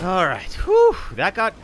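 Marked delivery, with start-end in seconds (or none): 0.6-0.9 high, loud blown-out whew of relief; loud relieved whew. Real, not acted. Streamer relieved after surviving a close call, big loud whew. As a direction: let out a big breath of relief.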